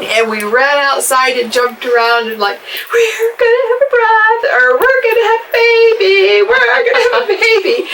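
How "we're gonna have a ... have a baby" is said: put-on high, loud, sing-out squeal of kids' joy; excited screaming. Sister re-enacts the kids hearing of the pregnancy, excited screaming. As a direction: re-enact children shrieking with excitement, pitched way up. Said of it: "Debbie and Brad and I screamed".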